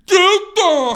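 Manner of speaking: loud, overblown dopey imitation; mocking, exaggerated delivery; mock pronunciation. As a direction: blurt the word as a hammed-up parody. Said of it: "I guess the right pronunciation is".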